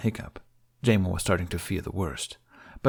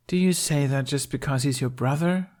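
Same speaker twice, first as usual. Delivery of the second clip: ordinary delivery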